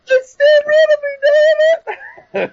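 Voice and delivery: high, slow, hammed-up sobbing wail; mock crying fan. Mocking fans who think wrestling is real, slow weepy wail. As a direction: blubber it like a heartbroken fan. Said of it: "crying because wrestling's real".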